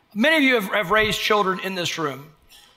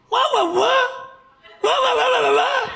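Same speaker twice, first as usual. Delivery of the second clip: muffled wah wah talk. Retold or imitated. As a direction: babble like a baby hearing noise. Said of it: "They're like".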